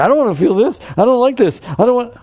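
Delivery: high, loud pleading protest; whiny complainer. Retold or imitated. A student protesting to the teacher about a feeling, high loud whine.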